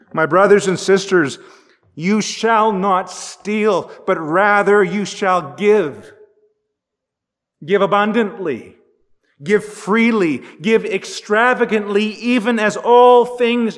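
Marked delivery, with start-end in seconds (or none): none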